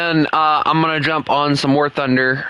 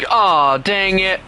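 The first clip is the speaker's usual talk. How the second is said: drawn out slowly, slightly higher; really slowly; 5 semitones higher, much slower than usual. Shown as a demonstration. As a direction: stretch each word out for comic effect. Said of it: "like, really slowly".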